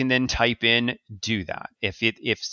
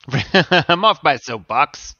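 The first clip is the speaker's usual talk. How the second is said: laughing through the words, slow; chuckles as he wraps up the rant; 4 semitones higher, 6 dB louder, much slower than usual. Real, not acted. Host laughing after ending his security rant.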